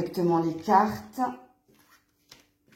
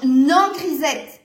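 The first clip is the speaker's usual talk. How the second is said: loud, high, drawn-out no to a cat; scolding shout; 5 semitones higher, 9 dB louder, much slower than usual. Real, not acted. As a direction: call out sharply to stop the cat. Calls out to stop her cat.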